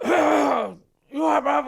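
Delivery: a loud, high, casual greeting called down the landing; loud, high "you all right, bruv" as a cover signal; loud warning call. Retold or imitated. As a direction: sing it out loud to tip off the others. Lads warning of the screw.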